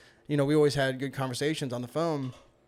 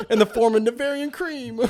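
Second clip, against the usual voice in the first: high, louder grand pronouncement; mock biblical proclamation; 8 semitones higher, 6 dB louder than usual. Friend naming the manna's form, mock-grand proclamation. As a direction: proclaim it with hammed-up reverence.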